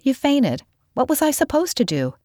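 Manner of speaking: put-on high, defensive girl's voice; high-voiced excuse; defensive protest. A narrator's reading. Callie defends herself after dousing him.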